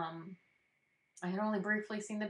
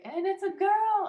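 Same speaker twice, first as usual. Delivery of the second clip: much higher and louder, slowed for the reveal; excited announcement; 14 semitones higher, 11 dB louder, slower than usual. Retold or imitated. Staff announcing the second baby is a girl, high and loud. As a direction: sing it out like the big reveal. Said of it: "they say".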